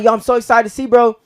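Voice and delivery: high, loud gushing; excited gushing. Retold or imitated. Telling mom and the cousin how excited he is. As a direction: hype it all up.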